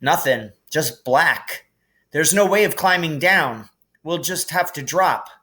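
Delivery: put-on anxious young witch peering down; a hint of an anxious girl; Hermione voice. A narrator's reading.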